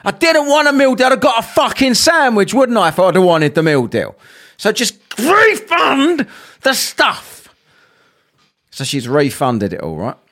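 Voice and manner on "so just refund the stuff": drawn-out slow exasperation; slow sarcastic order; slow exasperated demand. Retold or imitated. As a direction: spell it out slowly, fed up.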